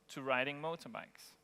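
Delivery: normal talking voice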